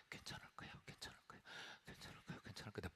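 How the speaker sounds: faint whisper, repeating it; whispered reassurance. Retold or imitated. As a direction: murmur it to yourself, barely audible.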